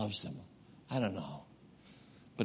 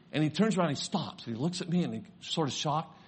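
normal talking voice

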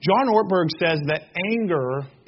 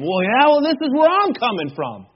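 higher-pitched, mock-heated comeback; exaggerated defensive insistence, pitched right up; escalating retort; 6 semitones higher, 4 dB louder than usual. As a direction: argue back harder, topping the last retort. Acted argument, escalating second retort.

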